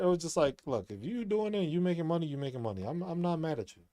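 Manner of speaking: ordinary talk, nothing marked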